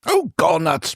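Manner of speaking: high startled yelp. Acted. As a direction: yelp it in alarm. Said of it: "Brad yelped".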